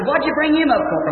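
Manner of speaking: high-pitched, mock-protesting question; puzzled protest. Hunter asking the Lord about the old eagle, protesting. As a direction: pitch it high as a retold complaint.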